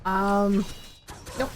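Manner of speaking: usual speaking voice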